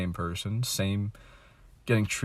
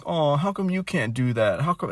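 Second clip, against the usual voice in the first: higher, nagging mock mom; mimicking mom; 6 semitones higher than usual. Vlogger imitates his mom nagging him, mild mock whine. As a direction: lightly voice mom's disappointed question. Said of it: "She's like".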